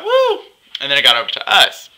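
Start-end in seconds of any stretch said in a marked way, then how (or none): 0.0-0.4 high-pitched "woo"; little high whoop of fake amazement; mock woo. Her reaction to the argument.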